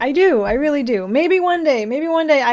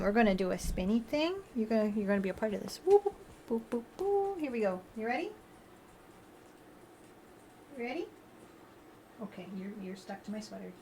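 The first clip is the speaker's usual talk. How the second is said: much softer, hushed coaxing; soft pet talk; 3 semitones lower, 16 dB quieter, faster than usual. Real, not acted. Softly coaxing the cat during a spinny game. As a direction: murmur gently to the cat.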